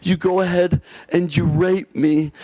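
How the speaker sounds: high, slow mock woman's voice, laughing through it; imitating Kat. Retelling the woman at gunpoint, imitating her high and slow. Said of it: "I'm gonna try to imitate her the best I can".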